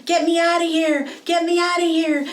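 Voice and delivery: high loud panicked yell; screaming. Acted. Shark fleeing the octopus, panicked scream.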